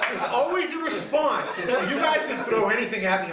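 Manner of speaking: high, loud and slow, laughing through it; laughing boast. Real, not acted. Bragging to his friends about his comebacks, laughing loudly. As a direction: brag while cracking up.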